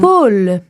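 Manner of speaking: poule with a clear open o. Shown as a demonstration. Teacher repeating poule with an open vowel. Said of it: "donc o".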